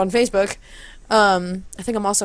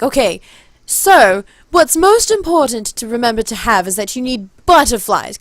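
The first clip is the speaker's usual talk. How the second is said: raised, loud stagey address; performing to crowd; 3 semitones higher, 6 dB louder than usual. Retold or imitated. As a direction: instruct an audience in character. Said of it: "I'm in front of all of these people".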